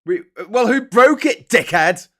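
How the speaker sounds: loud, high outburst of indignation; really angry. Real, not acted. Presenter rails at a politician's headline, really angry. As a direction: snap angrily at the culprit, pitch jumping up. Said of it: "I get really angry".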